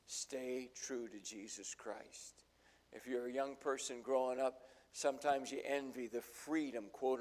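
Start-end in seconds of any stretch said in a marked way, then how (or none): none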